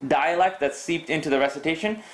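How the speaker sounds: ordinary delivery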